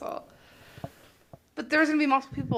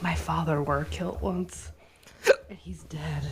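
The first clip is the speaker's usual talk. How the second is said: mock grieving; 7 semitones lower, slower than usual. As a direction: play it as hammed-up grief. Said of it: "What if she's like".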